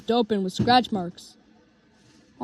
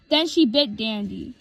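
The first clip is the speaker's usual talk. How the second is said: ordinary delivery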